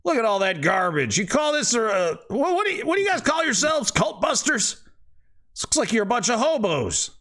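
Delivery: high, fast sneer; mock the cult busters high and quick; scornful father. GM voices father insulting party.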